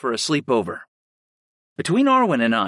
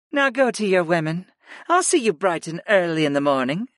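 higher, gentle woman's voice; female character voice; 5 semitones higher than usual. Acted. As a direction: voice Sarah lightly higher.

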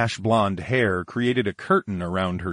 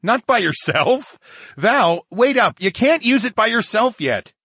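raised, higher-pitched objection; exasperated, louder warning; alarmed protest; 14 semitones higher, 6 dB louder than usual. Acted. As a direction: call out to stop someone in a hurry. Charles calls after Valerie to stop.

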